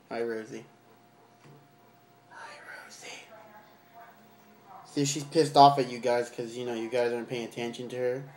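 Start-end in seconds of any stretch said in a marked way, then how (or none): 2.3-3.2 soft whispered greeting; hushed hello to a pet spider. Pretend. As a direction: breathe it without voice.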